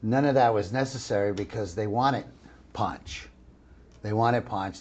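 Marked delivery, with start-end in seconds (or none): 2.7-3.3 whispered name. Shown as a demonstration. Naming the character he voiced.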